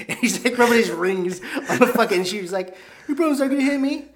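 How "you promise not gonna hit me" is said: high-pitched pleading question; pitched-up mock timidity; wary woman. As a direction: imitate a frightened woman. Woman afraid behind door, retold.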